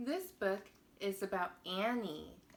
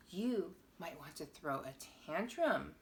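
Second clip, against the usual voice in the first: ordinary delivery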